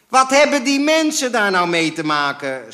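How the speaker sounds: very high, very loud outraged yell; shouting. A narrator's reading. Father protesting at what mother told caller, shouting. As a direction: explode in a full shout. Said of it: "schreeuwde mijn vader".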